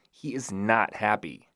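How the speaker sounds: ordinary delivery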